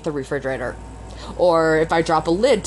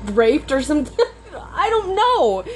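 laughing in disbelief; 7 semitones higher than usual. Real, not acted. Venting about trusting the universe, laughing helplessly. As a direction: let a helpless laugh push the pitch up.

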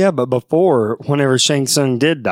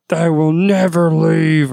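slow theatrical villain menace; 6 semitones higher, slower than usual. Retold or imitated. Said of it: "He's like".

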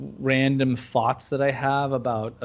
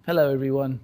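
put-on British accent; faster than usual. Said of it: "the rest of the podcast is gonna be British [name]".

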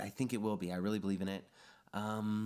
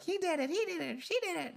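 very high-pitched mock little-girl chant; piping, put-on child voice; little girls accusing. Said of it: "it starts with these little girls".

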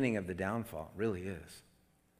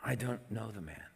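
softer, measured denial; solemn promise; 8 dB quieter than usual. A narrator's reading. Peter denying again with an oath, quietly insistent. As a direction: state it as a restrained oath. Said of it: "This time he's promising".

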